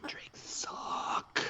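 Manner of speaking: slow breathy whisper. Real, not acted. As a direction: whisper it slowly. Drunk cohost whispering aside.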